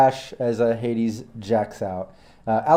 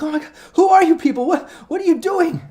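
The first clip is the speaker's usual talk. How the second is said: high, fast, frantic questions; panicked; 3 dB louder than usual. Acted. Frightened med tech confronting the intruders. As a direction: babble in terror.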